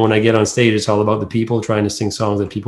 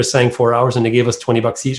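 ordinary talk, nothing marked

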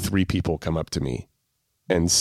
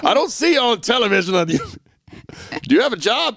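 nagging parent impression; 13 semitones higher, slower than usual. Imitating nagging dad.

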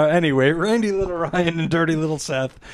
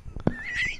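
squeaky high laugh; 9 dB quieter than usual. Real, not acted. Laughing at own Cockney bit.

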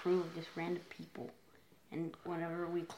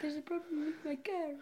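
high, soft mock Italian accent; light comic Italian accent; 10 semitones higher, 6 dB quieter than usual. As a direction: imitate an Italian man complaining. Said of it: "Do it in the accent. He was, like, Italian".

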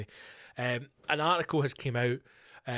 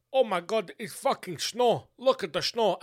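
loud, high mock-Russian astonishment; botched Russian accent; 7 semitones higher, 4 dB louder than usual. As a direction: do a bad Russian accent, exclaiming. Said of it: "I don't know what accent that is".

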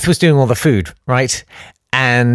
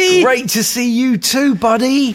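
much higher, fond greeting; fond returning dad; 10 semitones higher than usual. Acted. Astronaut dad to his son, high and fond. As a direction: greet your boy like a dazed spaceman.

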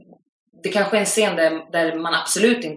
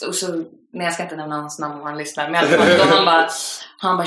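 usual speaking voice

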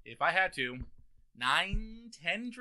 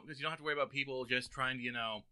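normal talking voice

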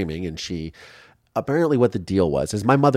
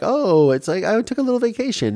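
mock breezy. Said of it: "she's not like".

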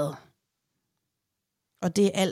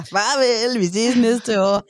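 mock cheerful goodbye; 2 semitones higher, 7 dB louder, slower than usual. Mock farewell to mother-in-law.

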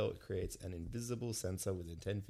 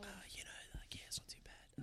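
stage whisper; 9 semitones higher, 11 dB quieter, faster than usual. Acted. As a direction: whisper so everyone can hear. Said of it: "We got a stage whisper".

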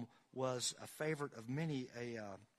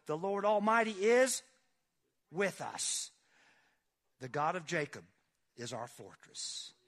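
louder, higher preacher's read, dropping away at the end; fervent scripture reading; 4 semitones higher, 5 dB louder than usual. Acted. Pastor reading God's words to the congregation with fervor. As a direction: declaim it, then let it sink. Preacher reads Psalm aloud.

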